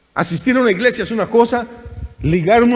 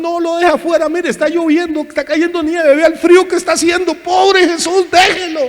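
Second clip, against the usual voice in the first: much higher, pity-laden begging plea; mock pleading altar call; 8 semitones higher than usual. Preacher mocks emotional altar calls, higher pleading whine. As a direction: ham up a tearful plea to let poor Jesus in. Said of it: "cambiamos el tono de voz".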